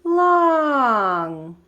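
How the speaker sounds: chanting long notes; demo rhythm. Shown as a demonstration. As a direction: stretch each long like a held note. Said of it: "like this".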